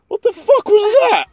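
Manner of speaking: shocked disgust. Real, not acted. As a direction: blurt it out, shocked by the taste. Reacting to a horrible drink.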